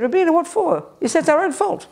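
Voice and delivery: high-pitched, casually amused retort; casual, amused dismissal. Retold or imitated. Monk answering a question about anger, casual and amused. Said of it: "he just laughed at me casually".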